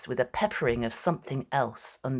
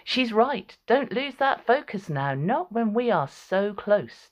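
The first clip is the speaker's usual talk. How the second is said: Tisiphone; 6 semitones higher than usual. A narrator's reading. Tisiphone urging, pitched up.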